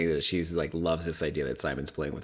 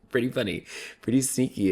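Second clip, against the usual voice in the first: repeated with a laugh, higher; 7 semitones higher, 3 dB louder than usual. Real, not acted. Vlogger still amused at the tennis match, laughing. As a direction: chuckle through it.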